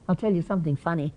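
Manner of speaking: usual speaking voice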